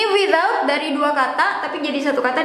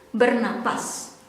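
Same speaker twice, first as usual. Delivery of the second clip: ordinary talk, nothing marked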